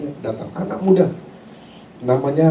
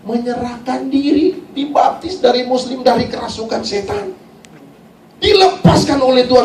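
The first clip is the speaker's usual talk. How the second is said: raised, emphatic preacher delivery; full-force preaching; 8 semitones higher than usual. Real, not acted. Preacher declaring deliverance to the congregation, full-force preaching. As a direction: proclaim it high, stretching key phrases.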